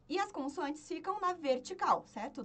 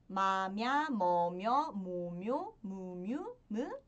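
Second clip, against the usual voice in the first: low "Ma, mya" in pairs, each pair sweeping upward; sing-song run through the vowel table; syllable drill; 6 semitones lower, 3 dB louder, much slower than usual. Shown as a demonstration. Teacher counting along the row to find mi, syllable drill.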